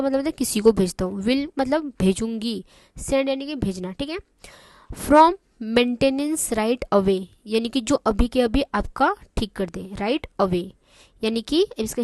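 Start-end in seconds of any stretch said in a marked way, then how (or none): 4.9-7.2 very slow, word by word; slow and clear. Shown as a demonstration. Teacher reading the receptionist's phrase, slow and clear. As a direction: read it out slowly for the class. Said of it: "मैं इतना आराम आराम से इसलिए बोल रही हूँ".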